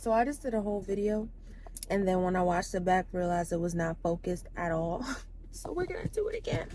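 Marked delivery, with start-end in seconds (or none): 5.5-6.7 whispered through a laugh. Real, not acted. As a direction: giggle it under your breath. Laughing at failed video.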